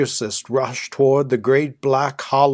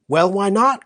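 higher, half-angry retort; half anger; 6 semitones higher than usual. A narrator's reading. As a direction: snap back, only half annoyed. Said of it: "cried Flora in half anger".

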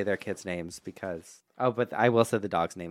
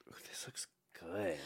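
soft unvoiced whisper; 3 semitones lower, 17 dB quieter than usual. Real, not acted. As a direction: breathe it like ASMR. ASMR snack tasting.

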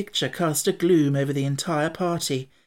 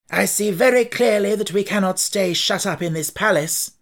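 decisive; 6 semitones higher, 5 dB louder than usual. Acted. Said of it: "with decision".

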